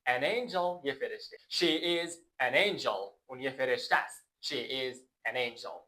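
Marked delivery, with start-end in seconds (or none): none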